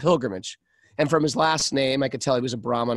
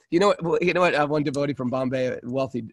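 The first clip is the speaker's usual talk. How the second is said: usual speaking voice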